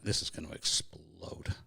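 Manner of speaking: put-on a child's anxious whisper as the row builds; worried whisper. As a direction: whisper it like a scared kid on the stairs.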